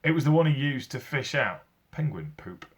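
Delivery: usual speaking voice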